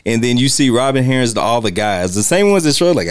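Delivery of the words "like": usual speaking voice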